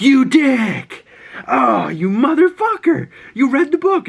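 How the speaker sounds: high, loud playful indignation; mock outrage. Girl whose joke's punchline was spoiled, mock outrage. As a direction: exclaim in exaggerated, high-pitched exasperation.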